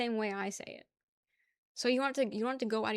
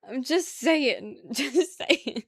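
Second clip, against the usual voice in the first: loud, drawn out through laughter; laughing; 2 semitones higher, 7 dB louder, much slower than usual. Real, not acted. Streamer teasing chat about accents, laughing. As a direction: laugh hard as you say it.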